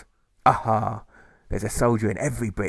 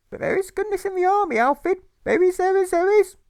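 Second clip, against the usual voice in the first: much higher, louder insistent urging; shrill mother; 6 dB louder than usual. Acted. Mother urging Alfred to enlist, much higher and insistent. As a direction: push him like a fanatic mother.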